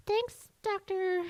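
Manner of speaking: high, slow, breathy thank-you; mock student voice. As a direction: drawl a sweet thank-you. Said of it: "who's like".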